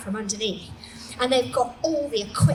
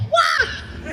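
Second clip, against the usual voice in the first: high, loud whoop; hype cheer; 4 dB louder than usual. Retold or imitated. The DJ boys cheering Suze on, loud whoop.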